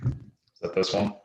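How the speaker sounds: popping, crackling microphone; crackly usb mic. Real, not acted. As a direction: talk through a crackly mic. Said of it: "your mic is popping a little bit".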